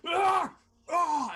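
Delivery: sharp high pained yells from the possessed man; two loud sudden cries of pain; pained cry. Acted. The possessed man's pained cries as the smoke leaves. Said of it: "He just sort of locks back and goes".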